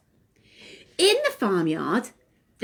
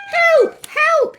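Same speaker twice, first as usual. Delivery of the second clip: high shouted cry for help; yelled; 6 dB louder than usual. Acted. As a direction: call it out high and loud. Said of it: "he yelled".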